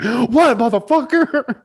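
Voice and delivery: high, loud challenge; mock confrontational yell. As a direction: square up and shout it at the nerds. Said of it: "be like".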